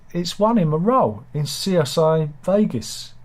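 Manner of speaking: normal talking voice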